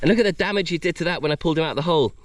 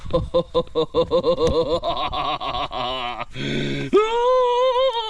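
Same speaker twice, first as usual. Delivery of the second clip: long stuttering, astonished laugh. Real, not acted. Seeing the crab's size, astonished laughter. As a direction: laugh on and on in disbelief.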